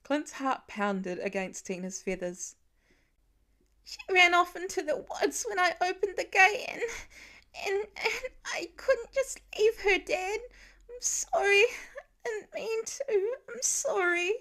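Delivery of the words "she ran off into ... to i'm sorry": put-on high child's voice, sobbing apology; a little boy crying out his sorry; boy sobbing apology to his dad. A narrator's reading. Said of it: "already blubbering".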